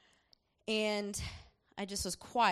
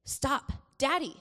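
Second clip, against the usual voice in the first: put-on child scolding; 4 semitones higher, 4 dB louder than usual. Son orders mom and dad to stop and apologize, mock stern. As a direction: lay down the law like a small child. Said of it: "he'll look at us and say".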